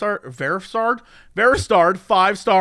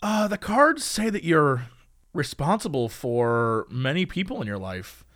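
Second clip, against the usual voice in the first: mystic fortune teller; 5 semitones lower, 4 dB quieter than usual. Acted. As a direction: read the cards like a seer. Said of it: "I'd be like".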